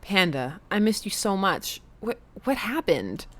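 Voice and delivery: ordinary delivery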